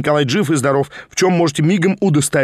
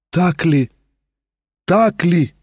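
trembling; 2 semitones higher, slower than usual. A narrator's reading. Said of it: "вся трепеща ждала".

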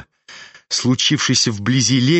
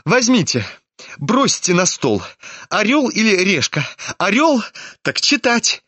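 high-pitched, urgent instructions; agitated Ippolit; 7 semitones higher than usual. Acted. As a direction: press for the coin toss in a raised, excited pitch. (взволнованно, высоким голосом)

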